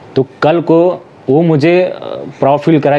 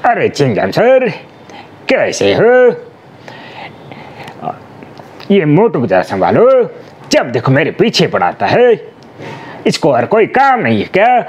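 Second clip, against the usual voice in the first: higher, slow put-on cartoon voice; dubbing demo for the kids watching, higher cartoon voice; cartoon character voice; 3 semitones higher than usual. Shown as a demonstration. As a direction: whine to the inspector like a cartoon sidekick. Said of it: "दिखा दीजिए".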